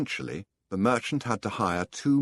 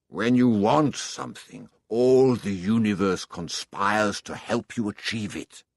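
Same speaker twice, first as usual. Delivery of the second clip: put-on loud, resounding old king voice; grand, ringing words of a remembered king; 5 dB louder than usual. A narrator's reading. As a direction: proclaim it like an old king's great truth. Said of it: "the old king had said".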